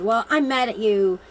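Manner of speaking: mildly heated, imitating the friend flipping out; mock angry outburst. Retelling a friend who flipped out at her, mock angry. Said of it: "she just flipped out".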